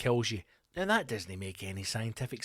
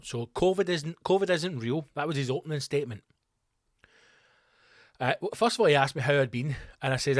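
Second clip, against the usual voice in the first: ordinary talk, nothing marked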